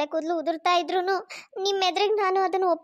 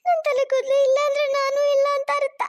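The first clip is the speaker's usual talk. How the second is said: crying; 8 semitones higher than usual. Acted. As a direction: wail as if her life is over. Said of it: "ಅಷ್ಟೊಂದು ಅಳ್ಬೇಡ [name]".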